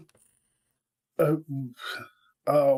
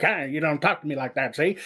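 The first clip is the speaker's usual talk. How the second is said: quick-talking thirties mobster; rapid-fire cartoon gangster; fast gangster talk. Shown as a demonstration. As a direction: rattle it off like an old movie gangster. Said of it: "talking fast like nineteen thirties gangsters".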